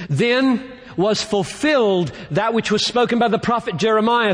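high-pitched, declamatory Bible reading; Scripture proclamation. Acted. Preacher reads prophecy to the congregation, proclaiming in a high pitch.

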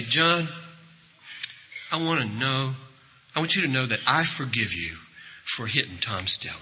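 hushed, soothing pardon with pauses; gentle forgiveness. Retold or imitated. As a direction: forgive him quietly and kindly. Acting a bystander forgiving the attacker.

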